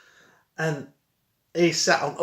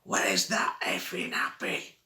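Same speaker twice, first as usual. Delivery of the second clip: slow low growl of the angry dad; gruff dad's shout, mimicked; 6 semitones lower than usual. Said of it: "I heard him go".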